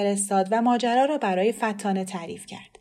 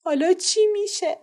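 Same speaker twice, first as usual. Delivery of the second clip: higher, a tearful wail; crying; 10 semitones higher, 4 dB louder than usual. Acted. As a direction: ask through mild tears. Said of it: "گریه کنان گفت".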